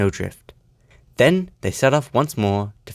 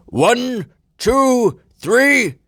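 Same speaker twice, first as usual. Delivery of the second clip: high, drawn ceremonial count; proud countdown; 14 semitones higher, 4 dB louder, much slower than usual. A narrator's reading. Fat Controller at the station before the lights, proud. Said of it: "proudly said".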